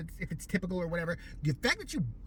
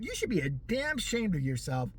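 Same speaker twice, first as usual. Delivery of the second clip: ordinary talk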